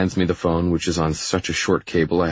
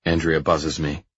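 ordinary delivery, nothing marked

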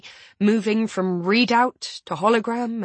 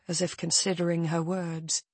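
plain reading voice

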